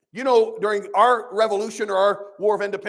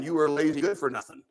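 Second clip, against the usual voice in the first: normal talking voice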